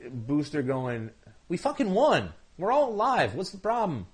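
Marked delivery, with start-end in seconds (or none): 1.5-4.1 high-pitched mock exasperation; mock protest. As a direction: protest in a raised, put-upon voice as Booster. Acts out Booster protesting to UN.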